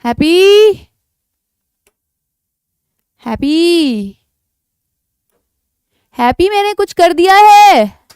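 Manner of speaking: high, drawn-out shout; calling out loudly. Real, not acted. Teacher calling Happy after doing something, loud drawn-out shout. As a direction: holler for someone off in the distance.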